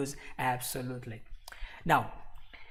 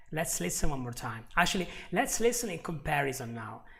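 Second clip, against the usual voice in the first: ordinary talk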